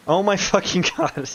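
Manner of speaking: high, loud exclamation broken by a laugh; real exasperated burst with a laugh; laughing disbelief. Real, not acted. Streamer seeing the game crash again, laughing in disbelief.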